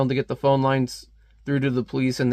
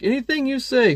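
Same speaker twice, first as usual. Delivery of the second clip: much higher, hammed-up voice; put-on high reply; 11 semitones higher than usual. Kid answering an order to move, put-on high reply. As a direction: jump high for a mock compliant reply.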